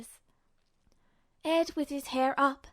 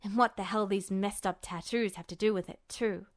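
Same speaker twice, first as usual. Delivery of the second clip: sharp frown; 5 semitones lower, 3 dB quieter than usual. Acted. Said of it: "sharp frown".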